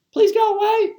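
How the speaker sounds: high-pitched mock pleading; lightly acted, polite begging; acted plea. Acts householder pleading with gunman.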